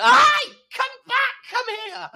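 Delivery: very high, loud call across the street; excited calling. Retold or imitated. Drag queen calling the teen back, very high and thrilled. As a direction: call them over in a thrill. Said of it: "I went".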